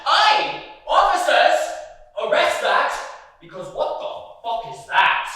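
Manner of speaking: loud, high mocking shout at the police; drunk heckler yell. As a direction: bellow it like a drunk fan after a match. Drunk football heckler yelling.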